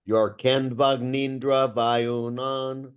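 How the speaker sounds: slow demo, Canvagnindra run together as one word; slowly. Shown as a demonstration. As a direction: say Canvagnindra slowly, no extra syllable. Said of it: "try doing it more slowly".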